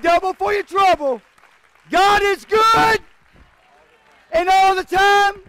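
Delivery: loud, high, shouted church call; hollering revival preacher; full-force preaching. Real, not acted. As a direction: whip the congregation up with every line.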